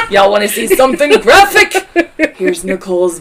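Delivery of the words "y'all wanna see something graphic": higher and louder, laughing through it. Real, not acted. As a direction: giggle the question out. Laughing while joking to listeners.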